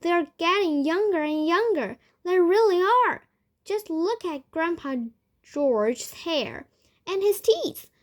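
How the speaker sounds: thrilled, loud exclamations; excited yelling. A narrator's reading. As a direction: report a miracle as it happens. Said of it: "Grandpa Joe was yelling".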